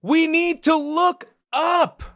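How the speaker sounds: high, drawn-out cry of command; theatrical yell at no great volume; acted yell. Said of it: "just kind of yells".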